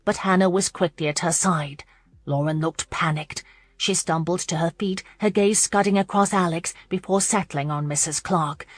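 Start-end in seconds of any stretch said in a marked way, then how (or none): none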